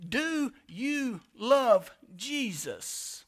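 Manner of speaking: drawn out word by word, weighing each; slow, pointed question. Real, not acted. Preacher puts the key question to the congregation, slow and pointed. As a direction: ask it very slowly, each word standing alone.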